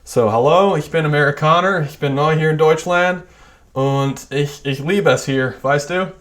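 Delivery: German with a thick American accent, hard American r's; exaggerated American-accented German; bad American accent in German. Shown as a demonstration. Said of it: "attempt at a really bad American German accent".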